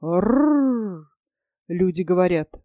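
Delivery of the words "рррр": rolled growling rrr; jaguar growl. A narrator's reading. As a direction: growl like a big cat. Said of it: "а ягуар зарычал".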